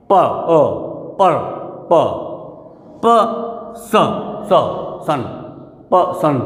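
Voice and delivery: पर्सन taken apart as प and सन, long pauses; syllable breakdown. Shown as a demonstration. Teacher showing two syllables, sounded out piece by piece. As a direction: isolate each sound of पर्सन, one at a time. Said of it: "दो syllable इसमें हो गए".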